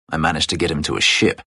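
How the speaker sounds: normal talking voice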